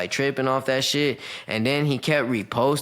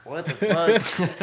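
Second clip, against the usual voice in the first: stunned disbelief; 6 semitones higher, much slower than usual. Retold or imitated. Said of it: "I was like".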